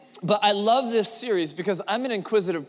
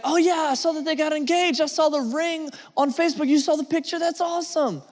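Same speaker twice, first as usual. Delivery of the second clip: much higher, bubbly chatter; excited gossip; 11 semitones higher than usual. Retold or imitated. Friend gushing about engagement photos, high and bubbly. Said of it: "you're talking to somebody and you're like".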